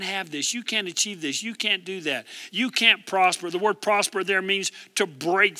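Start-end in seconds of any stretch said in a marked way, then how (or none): none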